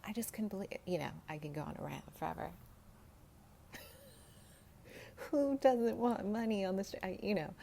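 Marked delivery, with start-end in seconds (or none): 5.3-7.5 laughing it out, pitched up and louder; giggly rhetorical question. Real, not acted. Laughing at her own joke.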